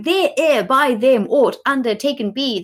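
"There ere by them aught undertaken be" read high and scornful, every word dragged out; mocking quote. Shown as a demonstration. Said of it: "seven words saying should".

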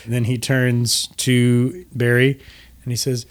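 ordinary talk

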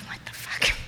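stunned, barely voiced whisper; acted whisper. Turning back to boyfriend with dad nearby, stunned whisper. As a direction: whisper it in mock disbelief.

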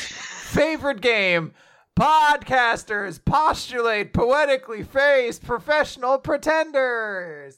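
high, drawn-out title announcement; hammed-up host intro, stretching the last word; game-show announcer. Host introduces the podcast's word game, announcer style.

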